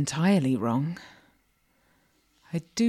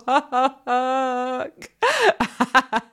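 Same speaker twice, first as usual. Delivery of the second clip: long, high, loud laughing fit; gloating laugh; 8 semitones higher, 6 dB louder than usual. Acted. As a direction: keep cackling, high and loud. Mistress keeps laughing.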